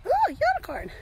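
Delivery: excited. Real, not acted. Spots unicorn excitedly.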